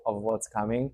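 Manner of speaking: usual speaking voice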